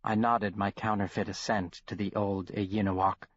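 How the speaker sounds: usual speaking voice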